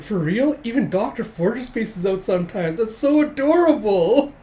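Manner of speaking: high, swooning fan voice, stretching the end; hammed-up delighted squeal building to the end; gushing adoration. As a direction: coo loudly like a smitten admirer. Admirers gush over doctor, acted.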